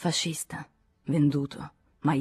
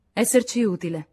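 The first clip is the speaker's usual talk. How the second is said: irritated echo, higher and louder; 6 semitones higher, 5 dB louder than usual. A narrator's reading. Lachaume objecting to Henri's offer of help, irritated. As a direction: throw the words back, annoyed. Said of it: "con voce irritata".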